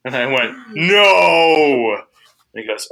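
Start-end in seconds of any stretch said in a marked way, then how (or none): 0.7-2.0 loud, drawn-out no; hammed-up long, loud denial; emphatic denial. Emphatic denial to marshal, acted.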